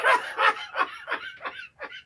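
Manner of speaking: shrieking laugh, way up high; high squealing laugh. Real, not acted. Host shrieks with laughter at the caller's teasing. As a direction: let out a high delighted squeal.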